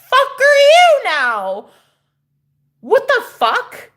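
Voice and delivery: very high, drawn-out screech; acted high-pitched outrage; outraged yell. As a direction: yell in shocked disbelief, stretching it out. Acted shock at second stranger.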